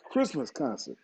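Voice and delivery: coughing through the words; clearing a coughing fit; coughing up water. Real, not acted. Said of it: "Uh-oh, he's coughing".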